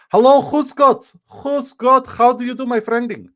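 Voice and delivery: loud, high mock-Russian greeting; Russian accent. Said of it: "super good Russian accent".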